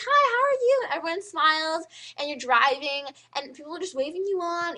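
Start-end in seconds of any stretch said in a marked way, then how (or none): 0.0-0.8 high, loud neighborly hello; perky mock-sweet reply; cheery greeting. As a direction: answer a neighbor with exaggerated friendliness. Acts out friendly reply.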